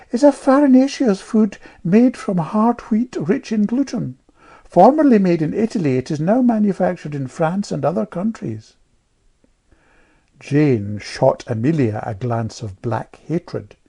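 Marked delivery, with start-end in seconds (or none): 0.1-8.7 put-on high, louder piping girl; piping know-all child. A narrator's reading. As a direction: pipe up like a little know-all. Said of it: "piped Amelia Tweedale".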